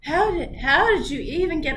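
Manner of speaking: ordinary talk, nothing marked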